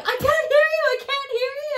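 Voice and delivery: high, loud, panicked "I can't hear you"; frantic shout. Retold or imitated. Woman answering a terrified friend, high frantic shout.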